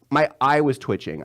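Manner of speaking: ordinary delivery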